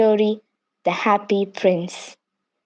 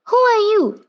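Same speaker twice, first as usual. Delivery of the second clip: much higher, louder bird voice, a small cry; startled little bird; 13 semitones higher, 3 dB louder than usual. A narrator's reading. Bird calls up to the weeping prince, high and startled. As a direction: call up in surprise at the statue.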